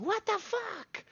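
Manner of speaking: high, slow mock squeal; imitated squeal. DM imitating the player character's outraged squeal. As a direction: echo the crying character's shriek. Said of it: "Tiberius going".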